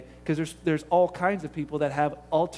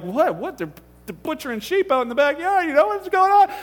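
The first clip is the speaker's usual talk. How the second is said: bewildered; 8 semitones higher, 5 dB louder than usual. Retold or imitated. Bewildered onlooker at tongues talk.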